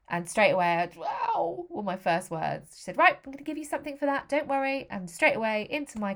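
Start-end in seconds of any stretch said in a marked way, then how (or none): 1.0-1.7 high-pitched retold "ow"; pained cry. First words on waking in pain. 3.0-4.9 higher, louder, brisk nurse voice; reassuring nurse. Retold or imitated. Recovery nurse reassuring patient in pain.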